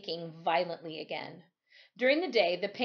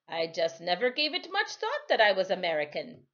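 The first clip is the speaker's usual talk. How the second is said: louder, higher West Indian lilt, easygoing; a Caribbean sailor shrugging it off; Timothy, island sailor; 5 semitones higher, 5 dB louder than usual. A narrator's reading.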